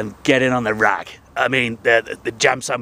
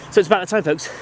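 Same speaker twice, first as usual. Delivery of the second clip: ordinary talk, nothing marked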